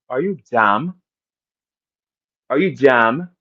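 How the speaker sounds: imitation New York accent. Shown as a demonstration. Asking Holly how New Yorkers say it, mock New York accent. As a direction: put on a New Yorker's accent, rising at the end.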